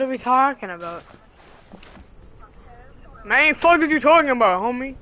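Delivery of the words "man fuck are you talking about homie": mock tough-guy retort. Mock confrontation with friend.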